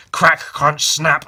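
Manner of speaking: fight sound effects. Retold or imitated.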